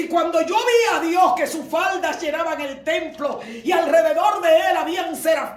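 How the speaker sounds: high, loud preaching at full force; prédica a gritos. Acted. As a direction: shout the vision out to the congregation. Preacher acts out prophet's vision. (prédica exaltada, aguda y a gritos)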